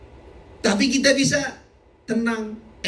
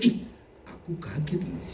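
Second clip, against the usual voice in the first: softly hammed-up gasp of surprise, then a laugh; mock startled voice; 2 semitones lower, 7 dB quieter than usual. Preacher mocking a surprised God, lightly hammed-up. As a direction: play someone caught off guard, half-joking.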